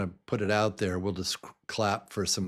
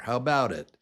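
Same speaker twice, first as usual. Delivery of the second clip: ordinary delivery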